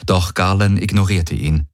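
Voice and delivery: ordinary delivery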